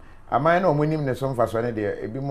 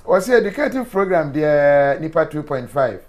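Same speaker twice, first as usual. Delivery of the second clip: laughing loud retelling; 5 semitones higher, 8 dB louder than usual. Retold or imitated. Host laughing, quoting himself loudly.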